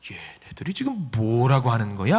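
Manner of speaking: low, stunned disbelief; dumbfounded. Acted. Jesus baffled by what James and John said, dumbfounded. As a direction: mutter it low, at a loss for words. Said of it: "너무 당황스러운 거예요".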